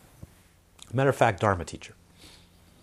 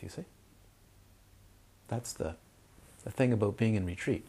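ordinary talk

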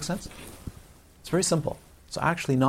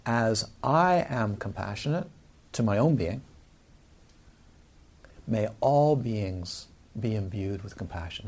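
slow, measured recitation; slow, solemn recited vow; reciting a formula; 3 semitones lower than usual. Shown as a demonstration. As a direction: intone it slowly like a blessing. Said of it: "So the formula for compassion is".

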